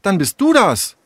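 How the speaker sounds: high, accusing tone; indignant girl Tessa. Acted. Tessa turning on Sarah in the quarrel, indignant.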